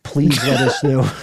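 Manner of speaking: ordinary talk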